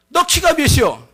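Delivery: high Chungcheong challenge; sharply pitched question in Chungcheong speech; Chungcheong dialect. Acted. Spy quizzes a listener's height, Chungcheong accent. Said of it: "충청도 버전으로".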